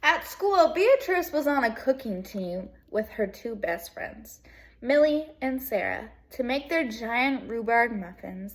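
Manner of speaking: lively read-aloud to second graders; bright classroom storytelling; storybook reading. Acted. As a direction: read it like story time for kids.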